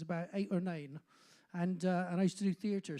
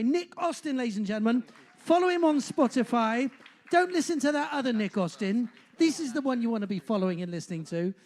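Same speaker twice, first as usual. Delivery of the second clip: announcer; 2 semitones higher, 7 dB louder than usual. Real, not acted. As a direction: announce the act to the whole room, loudly. MC announcing the act.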